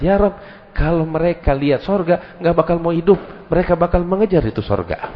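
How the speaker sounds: high, earnest appeal; angel pleading. Acted. Angel telling God people would chase heaven, high and earnest. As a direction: voice the angel petitioning the Lord.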